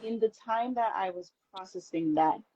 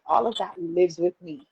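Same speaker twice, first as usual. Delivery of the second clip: ordinary delivery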